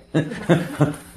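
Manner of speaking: quick unvoiced laugh; short breathy chuckle. Real, not acted. Preacher laughing at the blind man's taunt, a breathy chuckle. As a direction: laugh at the jab. Laughing at his own joke.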